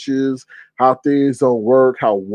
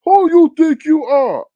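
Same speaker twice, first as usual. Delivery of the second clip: much higher, loud mock angry yell; imitated yelling; 13 semitones higher, 6 dB louder than usual. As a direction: shout like Lemon, high and loud. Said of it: "all that yelling".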